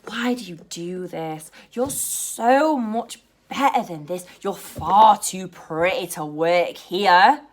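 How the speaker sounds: slow, drawn-out condescending customer; patronising. Retold or imitated. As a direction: lay on the concern, stretching the words. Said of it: "the rude, patronising ones".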